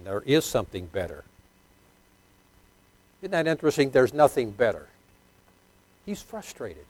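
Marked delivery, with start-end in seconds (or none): none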